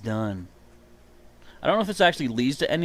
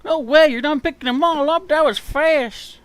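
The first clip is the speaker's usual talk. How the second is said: high, loud, stunned and impressed; amazed NPC voice; 13 semitones higher, 7 dB louder than usual. Acted. Voicing game character, amazed.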